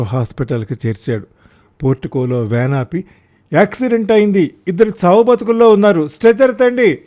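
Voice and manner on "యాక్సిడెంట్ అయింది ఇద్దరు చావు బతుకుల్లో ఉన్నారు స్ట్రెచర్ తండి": high, loud urgent yell; loud shout. A narrator's reading. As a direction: shout for a stretcher at the hospital door. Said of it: "గట్టిగా అరిచాడు".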